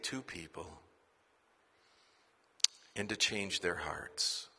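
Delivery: earnest prayer. Real, not acted. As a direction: pray over the couples gently. Closing prayer.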